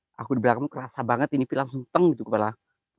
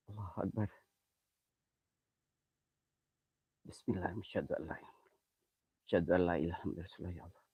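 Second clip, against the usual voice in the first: murmured prayer; 6 semitones lower, 15 dB quieter, much faster than usual. Real, not acted. Prays during ghost hunt.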